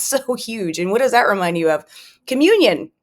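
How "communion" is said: higher and louder, landing the word Communion with a falling tune; emphatic answer. Real, not acted. Answering her own question to listeners, an emphatic reveal. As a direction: announce "Communion" like the punchline of a riddle.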